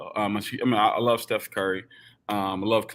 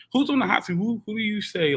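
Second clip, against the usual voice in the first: excited; 11 semitones higher than usual. Real, not acted. Posing the big question.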